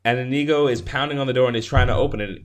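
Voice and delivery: ordinary delivery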